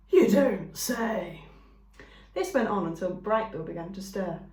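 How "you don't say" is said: skunk voice. A narrator's reading. Skunk listening politely.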